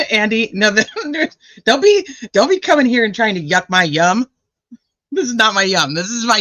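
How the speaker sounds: laughing scold. Real, not acted.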